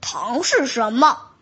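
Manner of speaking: deep, puzzled big tiger; low curious beast voice. Acted. Tiger asks about candy.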